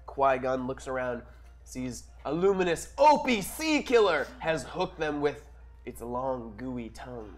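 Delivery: normal talking voice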